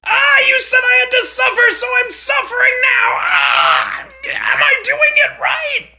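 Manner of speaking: high, loud strained cries; pained shouting; yelling in pain. Acted. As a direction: force the words out through agony. In pain, bleeding.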